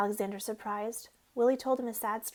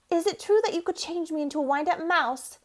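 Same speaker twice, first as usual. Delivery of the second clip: quivering voice; 5 semitones higher, 5 dB louder than usual. A narrator's reading. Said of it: "in a quivering voice".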